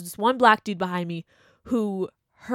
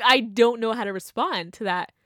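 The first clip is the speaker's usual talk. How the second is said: ordinary talk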